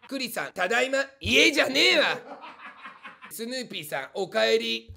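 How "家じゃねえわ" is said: loud, higher-pitched comeback; mock-indignant retort. Streamer rebuffing a viewer's homecoming greeting, loud and indignant. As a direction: shout it back as a comic objection.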